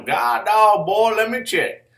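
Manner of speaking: mildly mocked, high reply; lightly voiced exasperated self; drawling dealer reply.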